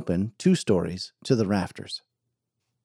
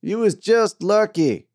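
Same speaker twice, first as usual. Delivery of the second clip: higher, louder, slow heckle from the bar; tired miner calling out; 11 semitones higher, 5 dB louder than usual. Acted. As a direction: call it out across the saloon. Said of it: "called out a tired miner".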